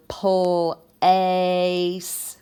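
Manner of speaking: "pull, ace." in two held chunks, the long A drawn out; stretched long vowel. Shown as a demonstration. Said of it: "I hear the long vowel".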